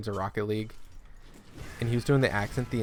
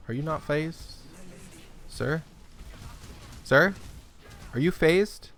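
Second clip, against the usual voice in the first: mock polite pestering; 6 semitones higher than usual. Pestering boss to get phased.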